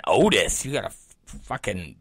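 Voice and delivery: low aside in disbelief; inner thought. Retold or imitated. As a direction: mutter it under your breath. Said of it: "And I'm thinking".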